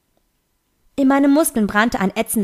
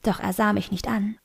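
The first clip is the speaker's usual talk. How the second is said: ordinary delivery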